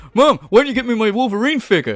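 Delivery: mock a spoiled kid ordering a parent about; Eric Cartman. Spoiled son demanding a toy from mother, mock bratty voice. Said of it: "he's like Eric Cartman".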